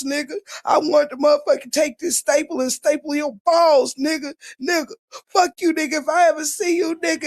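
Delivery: high put-on threatening rant; imitating a ranting voice. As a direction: play the cousin who talks like that, hammed up. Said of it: "I got a cousin that talk like that".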